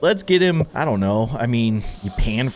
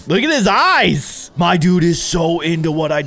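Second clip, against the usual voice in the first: amazed; 4 semitones higher, 5 dB louder than usual. Real, not acted. Player thrilled by his customer's reaction, amazed. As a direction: gush loudly, stretching the words.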